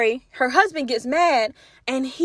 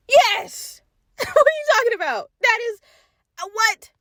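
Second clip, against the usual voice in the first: very high-pitched disbelief; high, laughing, flabbergasted reaction; incredulous outburst; 10 semitones higher than usual. Acted. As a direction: squeal it in comic outrage. Incredulous answer to husband's question.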